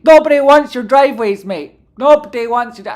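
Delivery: loud, high dismissive "Nobody"; mock the ad with a sneer; scornful jab. Real, not acted. Mocking an on-screen advertiser.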